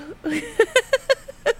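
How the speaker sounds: teary laugh. Real, not acted. Tearful break mid-sentence.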